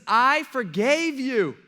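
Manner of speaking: loud, high, drawn-out rebuke; angry master. Acted. Master rebuking the servant he forgave, angry. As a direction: throw it at him slowly and forcefully. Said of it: "because he was angry".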